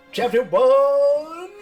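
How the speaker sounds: loud, high, long-held title call; hammed-up booming chapter announcement; mock announcer. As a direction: belt out the title, stretching the last word.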